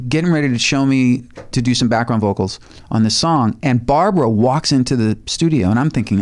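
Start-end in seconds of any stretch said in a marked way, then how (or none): none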